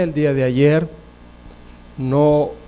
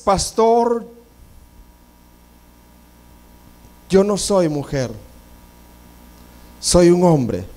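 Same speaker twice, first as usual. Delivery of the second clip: the visitor confessing. Retold or imitated. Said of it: "lo primero que le dice es".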